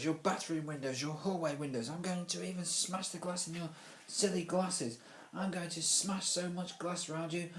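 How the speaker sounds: high, angry rant; growled threat. A narrator's reading. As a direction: threaten your classmate in a worked-up tirade. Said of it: "pointed at Ruskin and growled".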